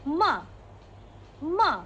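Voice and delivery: "Ma" pressed down sharply like scolding; fourth tone demo. Shown as a demonstration. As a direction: snap "Ma" downward as if angry. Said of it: "dia just tekan sahaja".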